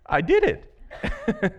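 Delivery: high, slow laughing confession. Retold or imitated. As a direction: let a laugh through it.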